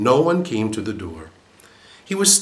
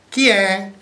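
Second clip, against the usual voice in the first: high, louder parrot call; 11 semitones higher, 6 dB louder than usual. A narrator's reading. Parrot calling at the door, high call. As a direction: squawk it like a parrot. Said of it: "il pappagallo disse".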